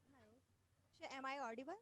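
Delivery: very faint, distant voice; speaking far from the mic; barely audible. Real, not acted. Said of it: "your voice is very low".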